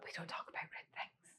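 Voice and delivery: secretive whisper; barely audible, hush-hush whisper. Acted. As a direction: whisper it like a taboo. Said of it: "people lower their voices".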